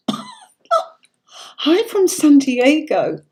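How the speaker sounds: a delighted, drawn-out hello read from the chat; gleeful read-out. Real, not acted. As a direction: savour the viewer's greeting with a laugh. Reading a viewer's comment aloud.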